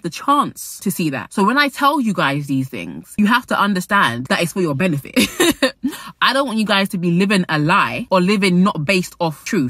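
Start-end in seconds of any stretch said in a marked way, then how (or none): 5.2-6.2 laugh. Real, not acted. Laugh after point.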